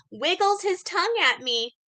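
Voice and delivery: ordinary talk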